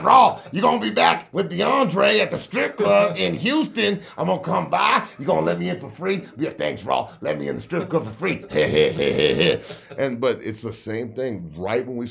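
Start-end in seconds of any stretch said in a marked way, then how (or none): 0.0-8.4 higher, louder mock coach taunt; teasing coach voice. Coach teasing Raw about the strip club, mock taunt. 8.5-9.9 loud cackling laugh; big laugh. Real, not acted. Laughing after his coach impression, big cackle.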